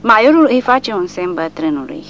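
plain reading voice

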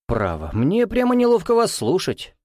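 high-pitched, chiding reproach; reproachful. A narrator's reading. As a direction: chide him, embarrassed on his behalf. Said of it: "проговорил он с укором".

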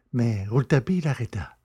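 ordinary delivery, nothing marked

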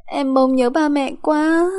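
put-on high, slow childish wail; crying child. Small daughter missing her parents, crying child voice. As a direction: whine tearfully like a little child. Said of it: "tiếng khóc lóc của trẻ con".

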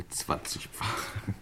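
muttered to himself. Acted. Uncle counts out money, muttering.

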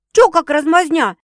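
loud, high-pitched scolding; barked. A narrator's reading. Sister scolding her meekness, barking. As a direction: snap at her for being weak. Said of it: "рявкнула Светка".

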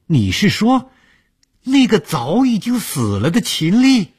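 high, loud horrified question. Acted. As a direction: ask in rising horror.

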